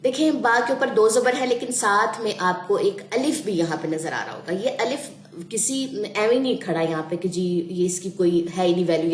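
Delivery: ordinary talk, nothing marked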